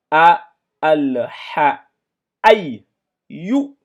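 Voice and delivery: loud syllables called out one by one; syllables drilled. Shown as a demonstration. Teacher drills the pupils syllable by syllable, loud. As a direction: call out each syllable. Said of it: "Ici, nous avons le me là".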